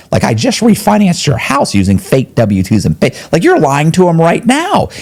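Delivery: high, incredulous protest; high exasperated disbelief. Retold or imitated. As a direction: voice jumping up in disbelief. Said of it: "I'm like".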